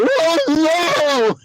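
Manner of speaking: high, drawn-out mock wail; dismayed rival fans. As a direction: imitate a crowd of fans groaning in dismay. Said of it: "five Patriot fans behind me go".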